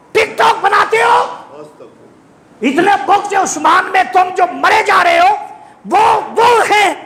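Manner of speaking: very high, shouted preaching in separate bursts; full-force sermon tirade, pitched high; fiery denunciation. Real, not acted. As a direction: berate the wrongdoers from the pulpit. Berates opponents from the pulpit.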